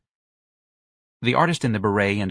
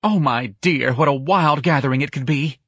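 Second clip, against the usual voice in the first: higher, theatrical delight; gushing excitement; 6 semitones higher, 4 dB louder than usual. Acted. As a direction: gush over a wild party idea. Young man gushes over party.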